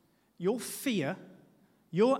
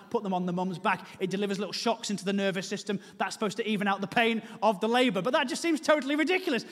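normal talking voice

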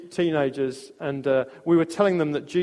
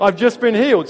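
higher and louder, retold cry of amazement; excited shout; 6 semitones higher, 9 dB louder than usual. Preacher retells the healed boy's repeated cry, higher and louder. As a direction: shout it out like you can't believe it.

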